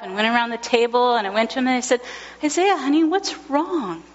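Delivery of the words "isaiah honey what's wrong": higher, gentle parent voice; tender concern. Retold or imitated. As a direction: soothe a crying child. Parent comforts crying son.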